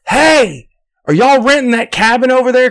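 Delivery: high, mock Southern neighbor voice; imitating the neighbor. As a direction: put on a high country-neighbor voice. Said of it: "dude comes up there and he goes".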